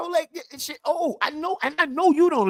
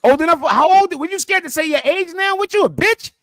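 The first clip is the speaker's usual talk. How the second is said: louder and higher, pushing hard; angry demand; 7 semitones higher, 3 dB louder than usual. Real, not acted. Arguing streamer loudly demands his rival's age.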